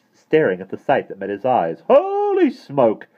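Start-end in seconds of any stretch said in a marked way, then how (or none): 1.9-3.1 high, loud drawn-out exclamation; astonished postman. A narrator's reading. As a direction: exclaim in amazement, stretching it out. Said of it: "he remarked".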